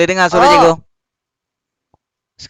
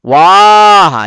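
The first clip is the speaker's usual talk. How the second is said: loud, drawn-out "Wah"; intonasi tinggi; 3 semitones higher, 9 dB louder than usual. Shown as a demonstration. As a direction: exclaim "Wah" loud and long, pitch arched up. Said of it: "yang ni intonasi". (sebut "Wah" kuat dengan nada tinggi)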